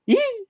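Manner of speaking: shrill high squeal of fright; frightened yelp. Acted. Ranta terrified by the gangster, a squealing yelp. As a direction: yelp like a kicked dog.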